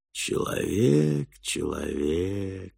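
very slow, quiet creature voice; low monotone boar. A narrator's reading. Wild boar calls to the man, low monotonous drone. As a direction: drone each word out softly, stretched long. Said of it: "низким и монотонным голосом".

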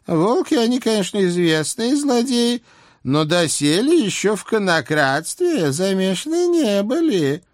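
put-on high nagging old hag; old witch voice. A narrator's reading. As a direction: scold like a fussy old witch. Baba Yaga voiced by reader.

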